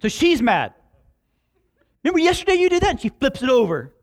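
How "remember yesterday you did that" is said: higher, louder mock-angry wife; mad wife. Pastor voicing Linda scolding Steve at dinner, mock angry. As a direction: throw his mistake back at him, mock fury.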